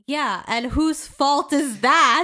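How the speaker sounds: loud, slow, mock-indignant comeback; indignant retort. Answering Zuko's father in her head, loud slow mock-indignant retort. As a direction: throw it back at the father as an accusation. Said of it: "And I was like".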